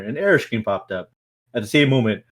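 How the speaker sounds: normal talking voice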